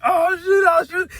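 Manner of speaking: high, excited mock alarm. Friends reacting to the old man's outburst, high excited. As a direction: squeal it like caught-out teens.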